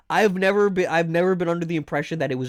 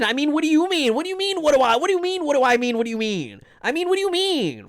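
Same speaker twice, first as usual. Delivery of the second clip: high, repeated what do you mean, baffled; incredulous; 8 semitones higher, faster than usual. Real, not acted. Streamer baffled by chat's claim, high and incredulous. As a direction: keep asking, more and more thrown.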